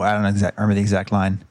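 ordinary delivery

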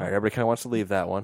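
fast, laughing through the line; amused rushed aside. Retold or imitated. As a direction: rattle it off while laughing. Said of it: "And you kinda look around".

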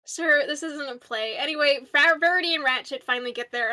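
ordinary talk

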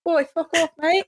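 put-on botched British accent, sounding Australian. Friend trying British for the group, slipping into Australian accent. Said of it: "That sounded very Australian".

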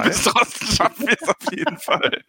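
high-pitched, giggling through words; laughing. Real, not acted. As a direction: laugh while repeating it. Repeats partner's line laughing.

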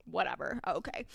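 plain reading voice

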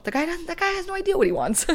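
high, fast disbelief; fed-up high mock rant; exasperated mockery. Real, not acted. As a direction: squeal it out in disbelief. Mocking exasperation about ex.

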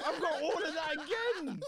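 high, loud, slow laughing groan; laughing despair. Retold or imitated. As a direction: laugh through disbelief.

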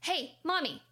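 imitating a little boy calling out to his busy mom. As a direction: call up to mom like a small child. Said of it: "the little boy says".

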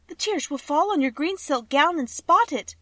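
put-on high, loud girl's warning; crying out in warning. A narrator's reading. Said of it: "cried the green girl".

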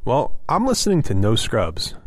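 usual speaking voice

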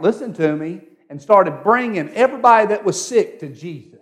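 high-pitched, fervent exhortation; full-force preaching. Acted.

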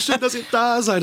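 imitating a happy street greeting; mock joyful greeting. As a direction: greet a stranger on the street, overjoyed.

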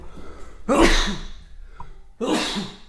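a real sneeze bursting out; sneezing. Real, not acted. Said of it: "j'éternue, dis donc".

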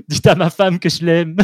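theatrical dying-hero farewell, high and loud; milk the fake death scene; hammed-up last words. Mock last words joke. (héros mourant théâtral, voix haute et forte)

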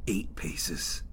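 whispered countdown; tense whisper. A narrator's reading. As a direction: breathe the steps out in a hush as the creature closes in. Tension counting down the steps.

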